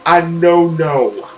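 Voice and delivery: slow, drawn-out no-no; slow echo. Real, not acted. Streamer repeating her verdict, slow and drawn out. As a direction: stretch it out deliberately.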